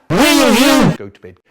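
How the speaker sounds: loud, slow bellow of go to bed; shouted order. Retold or imitated. Big brother shouting at little brother to go to bed, slow loud bellow. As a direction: bark it out slowly like an angry big brother. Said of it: "He'd shout".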